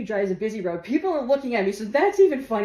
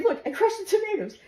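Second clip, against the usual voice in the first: higher, breathless explaining; high excited telling; 11 semitones higher than usual. Retold or imitated. Daughter telling dad, high and giggly. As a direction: blurt it out to dad.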